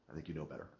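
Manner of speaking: ordinary talk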